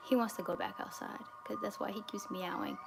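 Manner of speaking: whispered aside, no voice at all. Real, not acted. To viewers about the meowing cat, a whispered aside. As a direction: breathe the words out under the breath.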